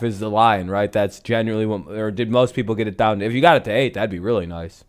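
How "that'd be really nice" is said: usual speaking voice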